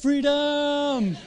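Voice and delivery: high, drawn-out rallying cry; movie-hero yell, not at full volume; mock battle scream. Shown as a demonstration. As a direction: hold the word long and high, half-screamed. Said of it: "Sorry, I didn't scream it".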